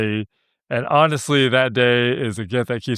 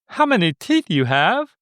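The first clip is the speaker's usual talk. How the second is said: a higher, shocked put-on British accent; 6 semitones higher than usual. Shocked London lady questioning him, in a mock British accent. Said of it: "that's my British accent".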